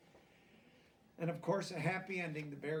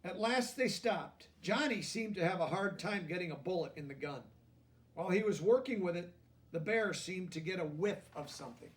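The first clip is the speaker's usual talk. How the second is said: gentle storybook reading, a touch higher; lively picture-book narration; reading to children; 3 semitones higher, 7 dB louder than usual. A narrator's reading. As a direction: read it like a bedtime story to kids.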